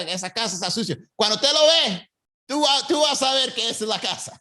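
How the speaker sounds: dish the neighbourhood gossip while giving directions; Cuban accent. Retold or imitated. Cuban local gossiping about a dirty house, high and lively. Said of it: "my Cuban accent was on point".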